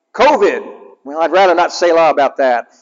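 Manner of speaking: normal talking voice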